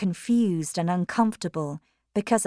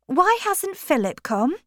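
put-on higher young woman; 11 semitones higher, 4 dB louder than usual. Maggie asks Stephen.

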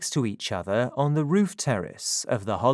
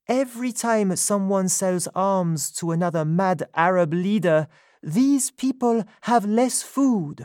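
higher, louder woman's voice, indignant; Sophie's voice; 8 semitones higher, 4 dB louder than usual. A narrator's reading. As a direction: speak up as her, indignant. Sophie speaking out.

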